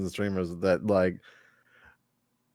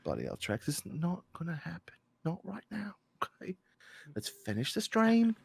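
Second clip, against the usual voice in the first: very soft put-on English muttering; hushed English-accented reluctance; English accent, getting into it; 6 semitones higher, 11 dB quieter than usual. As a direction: refuse under your breath in a mock English accent. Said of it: "I was getting into it".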